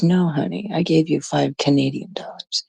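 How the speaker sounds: whispered, as a snide aside; a whispered little dig; sarcastic whisper. Acted. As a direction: drop to a sly whisper.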